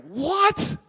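a dad stunned by his son's refusal; surprised. Retold or imitated.

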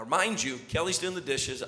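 ordinary talk

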